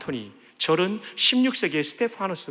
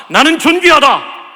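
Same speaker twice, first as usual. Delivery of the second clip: loud call-and-response; 9 semitones higher, 15 dB louder than usual. Shown as a demonstration. Pastor leading the congregation in a loud call. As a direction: shout it out high and proud. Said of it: "따라합시다".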